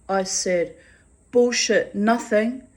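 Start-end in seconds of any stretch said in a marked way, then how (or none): 1.3-2.7 loud, high scornful comeback; retelling a loud retort to the drunk neighbour. Retold or imitated. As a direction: throw his word back at him. Snapping back at the neighbour.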